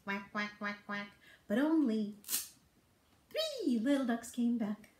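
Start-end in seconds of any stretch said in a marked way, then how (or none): 0.1-1.1 soft low quacks, a touch of a duck; mother duck quacking. Acted. Mother duck calling her ducklings, soft low quacks. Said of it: "Mother duck said".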